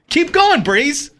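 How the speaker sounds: high, pushy nudge; insistent prompting. Real, not acted. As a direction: urge the co-reader on impatiently. Repeats the prompt to co-reader.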